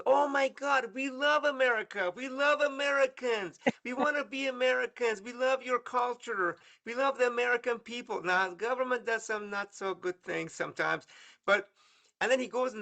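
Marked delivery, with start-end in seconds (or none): 0.1-8.2 much higher, excited gushing shopkeeper voice; gushing store owner. Retold or imitated. A Tehran bookstore owner tells the American guest, higher excited gushing. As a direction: gush over America like a thrilled fan.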